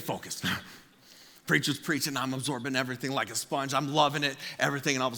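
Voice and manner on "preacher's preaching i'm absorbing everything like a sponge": ordinary talk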